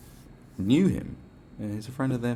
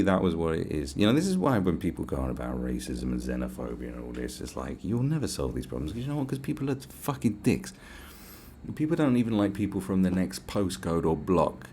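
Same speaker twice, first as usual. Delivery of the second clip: ordinary delivery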